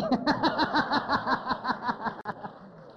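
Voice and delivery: real laugh breaking out high; high burst of laughter. Real, not acted. Laughing at granny's story.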